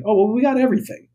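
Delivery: quick, high, chirpy waitress voice; breezy waitress. Retold or imitated. Idaho waitress breezily answering the customer, fast and high. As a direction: toss it off fast like a careless waitress. Waitress in retold restaurant story.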